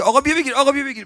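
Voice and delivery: high insistent vendor call. Retold or imitated. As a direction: shout it like a stall server pushing food. Vendor urging pilgrims at stall.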